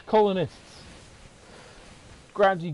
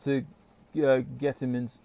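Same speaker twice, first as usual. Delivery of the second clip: ordinary delivery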